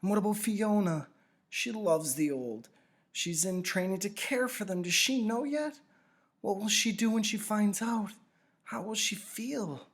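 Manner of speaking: put-on a worried boy asking about his friend, voice lifted high; young Jonas. A narrator's reading. Jonas pressing the giver about Fiona, anxious high boy's voice.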